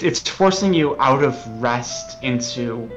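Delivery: ordinary talk, nothing marked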